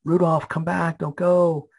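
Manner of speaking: put-on high, calling child's yell; boy yelling out. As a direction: call out the window like a small boy. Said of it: "and he yells out".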